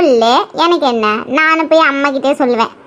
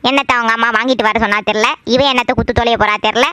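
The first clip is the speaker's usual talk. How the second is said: much lower, sour grumble to oneself; grumbling mother-in-law; 6 semitones lower than usual. Acted. Mother-in-law suspects the visitor, low grumbling to herself. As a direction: gripe under the household's nose in a low pitch.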